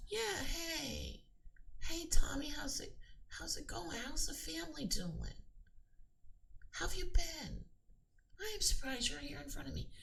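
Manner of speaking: high, timid, overly casual chatter; meek chatty subject. Shown as a demonstration. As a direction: play a timid friend greeting the king, voice way up high. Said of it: "You're not just gonna be like".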